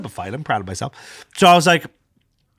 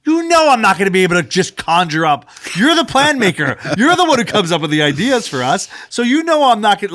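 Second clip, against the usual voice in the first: raised exasperated plea; 6 semitones higher, 3 dB louder than usual. Retold or imitated. Arguing with wife, retold.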